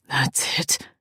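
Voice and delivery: low raspy male voice; rasping. Acted. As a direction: rasp it out, low and rough. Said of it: "he rasped".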